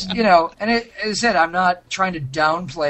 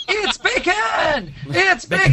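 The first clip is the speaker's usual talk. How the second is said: much higher, slow, shouted "It's bacon!"; dog food ad dog; 3 dB louder, slower than usual. Retold or imitated. Mimicking the dog food ad, high excited shouting. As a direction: yell it like the excited commercial dog. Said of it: "the dog food commercial".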